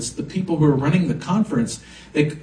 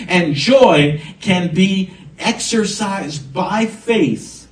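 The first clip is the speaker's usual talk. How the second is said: louder, punched proclamation; preacher hitting the peak; fervent preaching; 5 semitones higher, 4 dB louder than usual. Acted. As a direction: declare joy with rising force. Preaching climax on joy.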